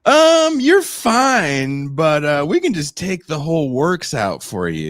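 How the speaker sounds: high, loud, chirpy sales pitch; cheery clinic staff. Retold or imitated. Clinic staff pushing surgery on a patient, high and loud. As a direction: oversell it like a pushy clinician.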